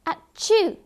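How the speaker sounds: high, loud storybook sneeze; acted sneeze. A narrator's reading. Soaked cat catches cold, acted sneeze. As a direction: burst out with a pretend sneeze. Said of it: "he sneezed".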